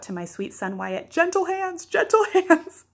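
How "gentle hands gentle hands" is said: put-on high, loud call to a toddler, second one stretched out; retold parental shout. Mother calling out to her two-year-old son, mock shouting. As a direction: re-enact a parent urgently shouting a warning. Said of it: "I had to shout out".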